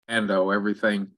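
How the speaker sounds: normal talking voice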